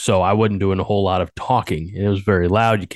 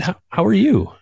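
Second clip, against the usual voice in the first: high squeaky voice; 11 semitones higher than usual. Pretend.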